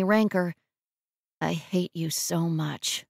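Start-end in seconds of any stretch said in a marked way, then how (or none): 1.4-3.0 slow, soft, rancorless; without any heat. A narrator's reading. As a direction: say it slowly and softly, no anger. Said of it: "saying without any heat".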